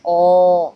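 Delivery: ओ slow and loud, held long; long stressed vowel ओ. Shown as a demonstration. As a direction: draw out ओ with weight. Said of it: "जिसमें stress दिया जा रहा है".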